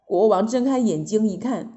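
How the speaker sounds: plain reading voice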